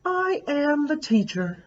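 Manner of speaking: high, childlike mock-teacher voice; a little girl playing teacher; pretending to be teacher. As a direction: kid acting grown-up teacher. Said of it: "Lily pretended to be Mister Slinger".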